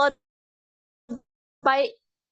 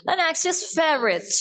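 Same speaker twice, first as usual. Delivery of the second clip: ordinary delivery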